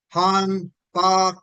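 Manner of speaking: syllable by syllable, with pauses between; slow, careful reading. Real, not acted. Lecturer guessing at Korean words for the audience, reading slowly. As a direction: sound out unfamiliar Korean words slowly, piece by piece. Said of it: "I'm reading that quite slowly".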